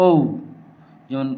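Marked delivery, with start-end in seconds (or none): 0.1-0.1 ও rounded into a glide; letter O said as a glide. Shown as a demonstration. Teacher modeling the right O. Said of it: "উচ্চারণ হবে".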